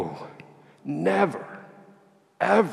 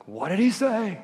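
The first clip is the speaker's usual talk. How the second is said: pitched up high, bursting to know; eager question; 9 semitones higher than usual. Retold or imitated. Priest pressing the beggar for news, eager and high. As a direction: ask like you can't wait another second.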